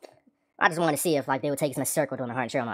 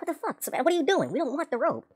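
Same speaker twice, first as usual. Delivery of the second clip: much higher, whiny complaining at the rope; mock bitching; 12 semitones higher than usual. Griping teen mocking the rope handed to his group, much higher and whiny. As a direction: complain at her in a high, whiny pitch. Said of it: "we actually bitched at her about it".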